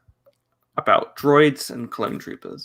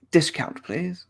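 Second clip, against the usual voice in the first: high-pitched hammed-up sponsor pitch; mock ad plug; 6 semitones higher than usual. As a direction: pitch it higher, half laughing. Mock sponsor discount request.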